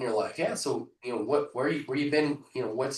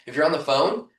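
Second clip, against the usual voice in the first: ordinary talk, nothing marked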